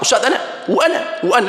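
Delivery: high, fast, rising calls for attention; eager pleading. Retold or imitated. Eager student calling out to be chosen, high and fast. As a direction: beg to be picked, eagerly.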